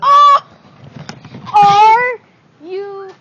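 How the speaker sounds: loud, high outraged yell; frustrated shout. Real, not acted. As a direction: yell it in disbelief. Loses the battle again.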